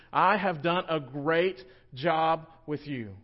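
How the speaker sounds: loud, rising preacher's build; fervent pulpit climax; preaching at full force. Acted. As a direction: drive it home to the congregation.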